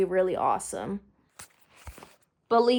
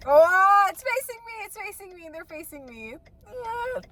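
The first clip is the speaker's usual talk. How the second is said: excited squeal; 10 semitones higher, 5 dB louder than usual. Real, not acted. Seeing the item reveal.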